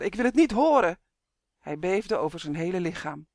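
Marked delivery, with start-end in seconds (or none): 0.0-1.0 high, loud anguished cry; screamed. A narrator's reading. Distraught elf refuses to hear more, screaming. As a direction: refuse in a raised, desperate yell. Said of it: "gilde Elvaar".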